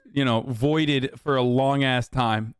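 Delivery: normal talking voice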